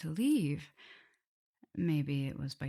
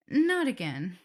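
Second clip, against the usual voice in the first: louder, higher flustered backpedal; flustered correction; 5 semitones higher, 9 dB louder than usual. Acted. Vampire hastily corrects her slip to the captive, flustered. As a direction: cover the slip in a hurry.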